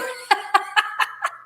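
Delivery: breathy laugh; airy laugh. Real, not acted. Laughing after punchline.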